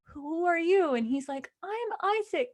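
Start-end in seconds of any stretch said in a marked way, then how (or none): 0.0-0.9 higher, timid-child imitation; a hint of a small child; lightly voiced little boy. Little boy meets stranger in forest, light child voice. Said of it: "the little boy is". 1.6-2.5 high, small imitated child voice; little boy. Little boy introduces himself to stranger, high child voice. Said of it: "the little boy is".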